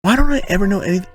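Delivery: high, fast self-pitying complaint; complaining high and quick about never knowing; mock whine. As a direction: whine it like a sulking kid. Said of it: "self-mocking whine".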